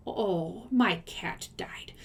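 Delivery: sad, slow whispered "my cat died". Real, not acted. Reading the grieving reader's news, slow sad whisper. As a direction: drop to a hushed, slow whisper.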